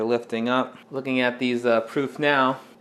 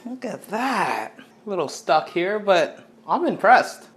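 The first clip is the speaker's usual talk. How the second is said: impressed; 7 semitones higher, 4 dB louder than usual. Real, not acted. Admiring the finished pizza.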